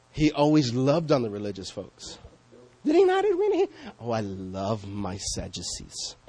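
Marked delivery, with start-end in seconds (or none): 4.0-6.1 soft, slow, sarcastic purr; mock the idea with a slow, sugary drawl; soft, slow, sarcastic mock-affection; mock sarcastic Jesus. Mock Jesus loving religious folk.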